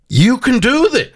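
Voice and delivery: high, drawn-out cheer; emphatic encouragement. Real, not acted. Host cheers on struggling caller, emphatic high encouragement.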